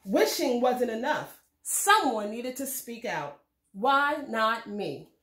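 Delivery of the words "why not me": plain reading voice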